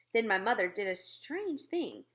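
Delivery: ordinary delivery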